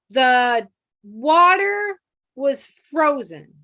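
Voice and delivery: very slow, each word drawn out; slow sounding out. Shown as a demonstration. A hearing kid sounding out words as he writes, slowly. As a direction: spell it out aloud slowly like a child writing. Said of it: "you sound it out as you write it".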